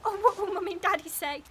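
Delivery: distressed wail. Acted. Rescued twin dreading Mummy and Daddy, distressed wail. Rescued child frets about parents.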